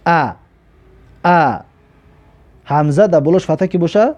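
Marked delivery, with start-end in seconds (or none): 0.1-1.6 "ا" voiced as a short open "a"; hamza with fatha. Shown as a demonstration. Teacher models hamza with fatha for learners, "ا" as "a". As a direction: say "ا" as a clear, separate "a".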